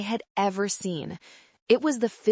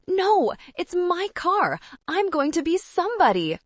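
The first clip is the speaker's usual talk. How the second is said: wailing; 12 semitones higher, 3 dB louder than usual. A narrator's reading. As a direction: wail it through tears. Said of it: "Kevin wailed".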